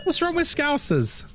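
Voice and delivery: high, slow, indignant; mock offended. Streamer mock-defending Scousers, high and slow. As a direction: ask it like you're personally offended.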